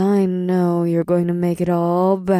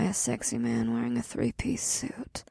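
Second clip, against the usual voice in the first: fast breathy whisper; 7 semitones lower, 10 dB quieter than usual. A narrator's reading. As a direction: purr it as a secret. Ada's flirty whispered confession.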